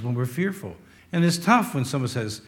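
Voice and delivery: ordinary talk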